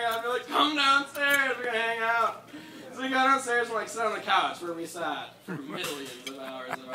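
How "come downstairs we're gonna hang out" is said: eager call. Retold or imitated. Friend waking him in morning.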